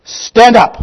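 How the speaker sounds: high, loud order to the dead; sudden commanding pulpit shout. Acted. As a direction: shout it like a command that opens graves. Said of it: "what has he said to the dead?".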